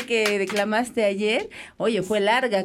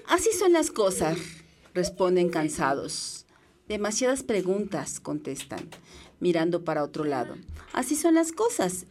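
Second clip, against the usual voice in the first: poem reading; 3 semitones lower, 4 dB quieter than usual. Acted. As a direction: read the poem gently and thoughtfully. Reads a poem aloud. (lectura de poema pausada)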